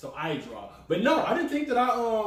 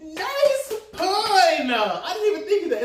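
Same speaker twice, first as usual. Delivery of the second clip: laughing; 9 semitones higher, 3 dB louder, much slower than usual. Real, not acted. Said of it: "laughs at pun".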